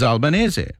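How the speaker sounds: Albanese with Italian vowels, ending in -eh-zeh; Italian pronunciation. Shown as a demonstration. Host explaining the father's surname, Italian pronunciation of Albanese. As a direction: give Albanese its Italian accent. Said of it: "say it with an Italian accent".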